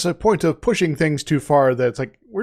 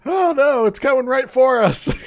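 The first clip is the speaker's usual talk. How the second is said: high-pitched, hammed-up alarm; mock panic; 10 semitones higher than usual. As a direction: playfully cry out in pretend terror. Voices monks fearing possessed apple.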